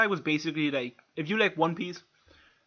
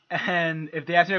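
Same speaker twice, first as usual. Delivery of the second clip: ordinary talk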